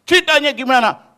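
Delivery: loud, high and fast angry question; upset demand. Retold or imitated. Teacher angrily asks pupil how he got beaten. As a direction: press the pupil hard, upset. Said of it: "Bapak itu kesel".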